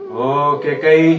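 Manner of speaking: loud, very drawn-out endearment; ardent wooing. Acted. King wooing his sulking queen, ardent wooing. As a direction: stretch the name out lovingly.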